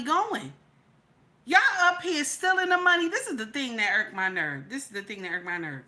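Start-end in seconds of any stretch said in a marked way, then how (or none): 4.7-5.8 softer, fast aside to himself; quick muttered repeat. Real, not acted. Ranter repeating his gripe to himself, softer and fast. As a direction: drop it to a quick under-the-breath repeat.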